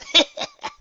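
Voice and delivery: squeaky pony chuckle; a quick high giggle. Acted. Dash chuckles over the headset, high and squeaky. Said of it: "A little chuckle came over Dash".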